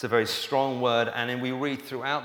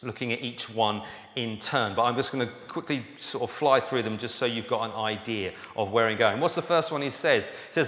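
normal talking voice

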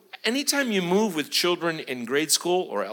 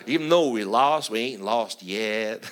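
put-on Southern accent; 6 semitones lower than usual. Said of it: "they're, in the South, they're still fighting".

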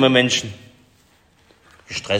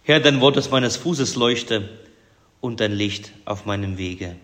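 hushed, devout prayer ending in a whispered amen; 3 semitones lower than usual. Acted. Pastor prays before the sermon, hushed and devout.

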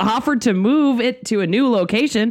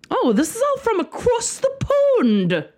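put-on terrible British accent; 13 semitones higher than usual. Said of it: "That was terrible".

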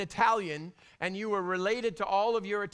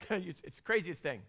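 usual speaking voice

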